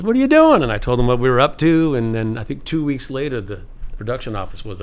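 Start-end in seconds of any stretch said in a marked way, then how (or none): 0.0-0.6 high, loud, eager question; imitating Spielberg's question. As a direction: ask with friendly curiosity. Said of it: "He says".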